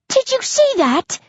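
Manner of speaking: very high-pitched amazed cry; astonished shout. A narrator's reading. Hunter calling to his friends about the escape, shouting high. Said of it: "Essex shouts".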